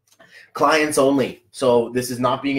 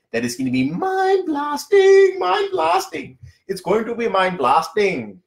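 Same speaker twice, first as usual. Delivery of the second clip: put-on stretched, sing-song Indian accent, much higher and slow; 13 semitones higher, slower than usual. Host hyping his clients-only event in a put-on Indian accent. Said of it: "That's my, that's my Indian".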